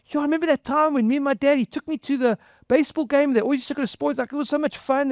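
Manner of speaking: high-pitched, quick run of happy memory; fond, excited reminiscing. Retold or imitated. Son recalling a ballgame with his dad, imitated excited reminiscing.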